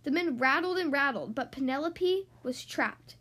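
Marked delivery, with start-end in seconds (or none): none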